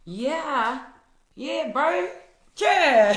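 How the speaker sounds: high, loud hammed-up Kiwi accent; exaggerated Kiwi banter. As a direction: ham up the New Zealand accent, swooping high. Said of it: "are you a Kiwi?".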